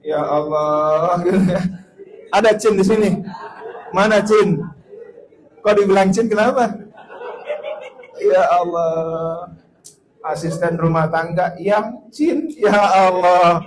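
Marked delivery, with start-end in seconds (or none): 0.0-1.1 slow, stretched Ya Allah; drawn-out exasperation. Real, not acted. Exasperated exclamation. 2.3-6.7 higher, louder amused teasing; loud mock outrage. Real, not acted. Mocking the word Cin. 8.2-13.6 slow, stretched Ya Allah; drawn-out exasperation. Real, not acted. Exasperated exclamation.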